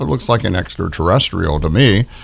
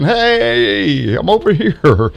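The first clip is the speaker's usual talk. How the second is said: high, bright call from far away; much higher playful voice of a waving creature; waving entity; 10 semitones higher, slower than usual. Retold or imitated. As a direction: call out like a tiny spirit waving.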